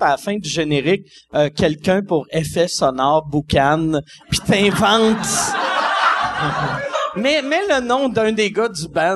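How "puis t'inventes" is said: laughing. Real, not acted. Laughing while joking about credits.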